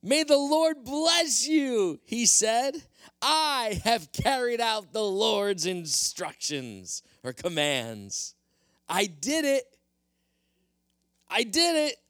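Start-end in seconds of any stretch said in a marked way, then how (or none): 0.0-2.0 high, slow and beaming greeting; bright, much higher cheerful king's welcome; cheerful Saul. Acted. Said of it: "Saul greeted him cheerfully". 3.2-12.0 high, proud and self-satisfied; climbing higher to a gleeful I did it; cheerful Saul. Acted. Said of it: "Saul greeted him cheerfully".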